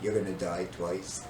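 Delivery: low, soft escalated warning; quiet threat. Retold or imitated. Older brother warning the boy still about to talk, low threat. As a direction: imitate a menacing brother, voice dropped. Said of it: "and I said".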